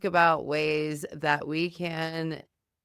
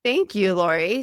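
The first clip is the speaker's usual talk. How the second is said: loud greeting; 9 dB louder than usual. Real, not acted. Co-host greets loudly.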